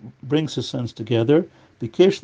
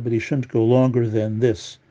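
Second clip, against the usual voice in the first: normal talking voice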